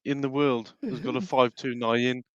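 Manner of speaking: slow, put-on Brummie; Brummie accent. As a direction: play a Brummie proud of the local bus, slowly. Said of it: "I can do a Brummy accent".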